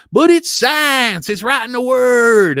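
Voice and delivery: high, loud, stretched-out caricature; hammed-up yell of disbelief, vowels dragged out; mock outraged congressman. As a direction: play a dense congressman loudly insisting. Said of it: "this conservative, uh, congressman questioning John Kerry".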